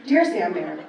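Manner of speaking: usual speaking voice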